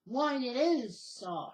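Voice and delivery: loud, drawn-out mock-grand answer; buddy's retold reply. Buddy Bill answering the telling-off, hammed-up reply. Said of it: "And he said".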